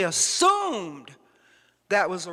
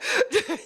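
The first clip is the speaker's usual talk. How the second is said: short high-pitched laugh; amused little burst of laughter; chuckle; 11 semitones higher than usual. Real, not acted. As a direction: chuckle at your own joke. Joking about a tan kid.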